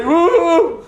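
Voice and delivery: high, loud yelped woo; alarmed yelp from a nervous duelist; startled woo. Acted. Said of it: "He, like, looks like".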